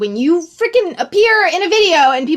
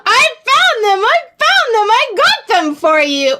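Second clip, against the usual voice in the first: much higher, louder triumphant cry; excited stranger; 10 semitones higher, 4 dB louder than usual. Retold or imitated. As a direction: call out proudly, waving the toothpicks. Stranger shouts triumphantly across store.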